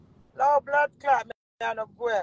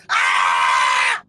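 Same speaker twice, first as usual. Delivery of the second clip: a loud, angry wordless yell; sudden angry yell; angry shout; 8 dB louder than usual. Real, not acted. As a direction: let out a furious yell. Rant climax shout.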